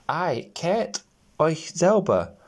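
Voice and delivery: slow, haughty brush-off; rude refusal. A narrator's reading. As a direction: sneer at the little men. Rude girl refuses the gnomes.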